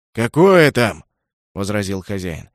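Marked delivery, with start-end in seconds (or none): 0.1-1.0 objecting loudly. Acted. Host objecting.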